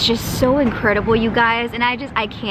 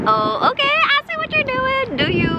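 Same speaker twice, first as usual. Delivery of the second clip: playful squeal; 13 semitones higher than usual. Retold or imitated. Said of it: "So I was like".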